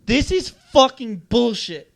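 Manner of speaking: slow, loud, defiant, each word hit; defiant repeat. Retold or imitated. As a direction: throw it back in his face, slowly. Repeats it to teacher's face.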